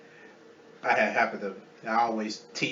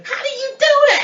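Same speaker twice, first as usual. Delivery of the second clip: very high, loud mock amazement; hammed-up baffled shout; incredulous outburst; 9 dB louder, faster than usual. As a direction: squeal the question in disbelief. Retelling own baffled outburst.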